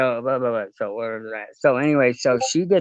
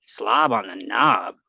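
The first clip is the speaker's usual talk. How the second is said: stunned repeat; 4 semitones lower, slower than usual. Retold or imitated. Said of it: "And I said".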